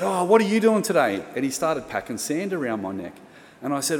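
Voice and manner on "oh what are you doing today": cheery higher, louder greeting, imitating a beach lifesaver; breezy mock-friendly hello, pitched up; happy, friendly. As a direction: imitate a chummy, easy-going lifesaver. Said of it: "happy clubby guy".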